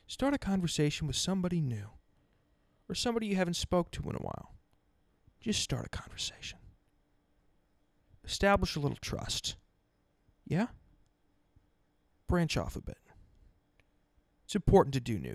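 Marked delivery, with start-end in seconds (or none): 5.4-9.6 soft, hushed plea to listeners; quiet urging. Real, not acted. Podcast host softly urging his listeners to reach out, hushed. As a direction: drop to a near whisper and urge.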